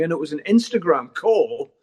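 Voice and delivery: usual speaking voice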